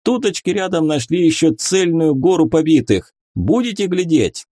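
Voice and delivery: high, eager folksy voice; rustic soldier reporting. A narrator's reading. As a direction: report keenly to the officer.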